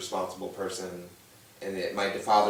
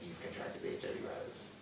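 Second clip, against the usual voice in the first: much softer, almost whispered; hushed news; 4 semitones lower, 16 dB quieter than usual. Retold or imitated. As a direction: whisper the bad news gently. Friend delivering diagnosis.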